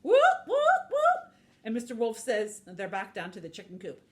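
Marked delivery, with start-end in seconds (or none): 0.0-1.3 high, loud rising whoops; vocal siren sound effect; imitated alarm siren. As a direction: whoop like an alarm, sweeping up each time.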